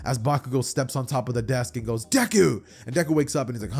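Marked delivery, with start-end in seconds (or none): 2.1-2.6 loud, high shout of a name; calling out. Acted. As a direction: yell to wake a dozing friend. Bakugo calls out to Deku.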